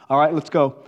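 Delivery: ordinary delivery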